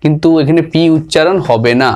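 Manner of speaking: normal talking voice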